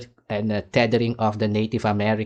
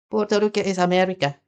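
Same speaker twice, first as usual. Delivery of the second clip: higher and faster, a sneering echo; scoffing repeat, pitched up and rushed; mocking; 9 semitones higher, faster than usual. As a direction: toss the line back in mock disbelief. Repeats the claim, second mocking take.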